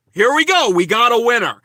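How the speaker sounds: loud, high shout of triumph; mock announcer excitement. Streamer finds a chat message to mock, shouting in mock triumph. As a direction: call it out like a prize draw.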